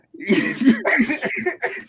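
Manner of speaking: high-pitched laughing fit; high burst of laughter. Real, not acted. Laughing at the Sinbad joke.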